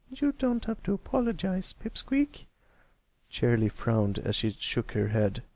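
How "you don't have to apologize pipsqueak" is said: high patient teacher voice. A narrator's reading. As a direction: speak as a kindly schoolteacher to a pupil. Miss Shirley voiced.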